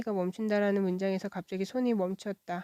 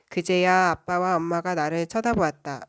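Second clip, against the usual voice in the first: ordinary delivery, nothing marked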